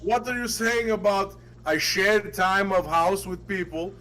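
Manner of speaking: puzzled, hammed-up Russian accent, broken English. Confused prankster questioning the timeshare seller, mock Russian accent. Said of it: "would do accents the whole time".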